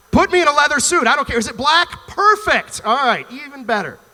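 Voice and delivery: high, loud, hammed-up eagerness; eager demand. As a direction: seize on it loudly, as the impatient ginger hero.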